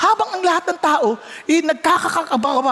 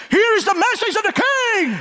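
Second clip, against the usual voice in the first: herald announcing; 7 semitones higher than usual. Pretend. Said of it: "Let's say I am a messenger".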